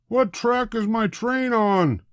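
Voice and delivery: high, slow puzzled man; confused passenger. A narrator's reading. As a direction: ask it slowly, lost.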